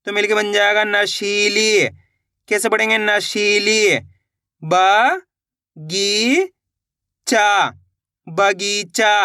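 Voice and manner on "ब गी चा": ब, गी, चा, each stretched alone; syllable-by-syllable sounding out. Shown as a demonstration. Teacher breaks a word into parts, ब, गी, चा said separately. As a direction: leave gaps between long ब, गी and चा. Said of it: "एक एक अक्षर का उच्चारण करके".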